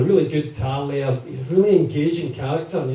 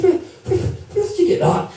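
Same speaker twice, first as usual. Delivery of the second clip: much higher, laughing disbelief; retold shock. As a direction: shriek the question in amused shock. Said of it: "I said".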